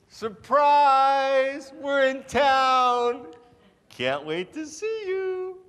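high, loud, over-cheerful relative; sing-song excitement, dragging out the big news; gushing surprise visit. Acted. Fake relatives announcing a visit, high and loud with glee. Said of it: "it would sound something like".

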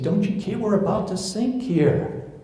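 frightened apostles waking Jesus in the storm; panicked apostles. Acted. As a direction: cry out as the boat goes under.

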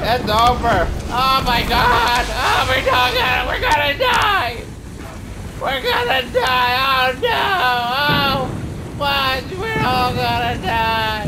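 loud, slow panicked wailing. Real, not acted. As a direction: shout it like you're going down. Crash in game, player panics.

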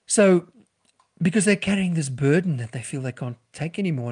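usual speaking voice

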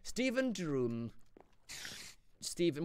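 slow, soft, swooning; mock-swooning over a donor; dreamy. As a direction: sigh the name like a crush. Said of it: "more like Stephen Dreams".

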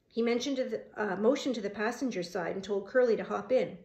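usual speaking voice

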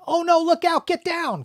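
very high, loud acted panic; urgent dubbed action-scene yell; alarmed warning shout. Shown as a demonstration. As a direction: warn everyone of an explosion, anime-style. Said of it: "'cause there was an explosion".

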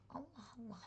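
breathy whisper of disbelief; a hushed, puzzled Allah Allah under the breath. Real, not acted. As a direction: whisper it to yourself, baffled. Puzzled mutter at game result.

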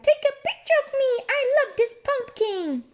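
put-on very high, fast little-girl squeak; Margaret's baby voice. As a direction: squeal it like a tiny sister posing for a photo. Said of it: "She's saying, like".

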